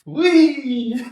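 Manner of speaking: high, loud gleeful squeal. Real, not acted. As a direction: squeal with glee. Playful squeal.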